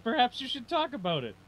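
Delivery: high-pitched gentle suggestion; high, mock-helpful voice; put-on high voice. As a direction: pitch it up like a little counselling voice.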